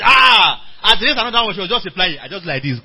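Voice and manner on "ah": loud, high cry of "ah"; retold loud "ah". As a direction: burst out with a sudden high exclamation. Retold interjection during the conference.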